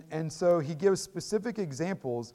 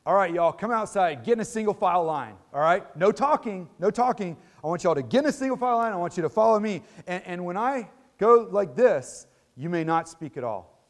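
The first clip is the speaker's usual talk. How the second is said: put-on herd the kids into line like a strict old teacher; first grade teacher; 3 semitones higher, 6 dB louder than usual. Old first grade teacher ordering a grown man into line, loud and bossy. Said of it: "to look at you and say".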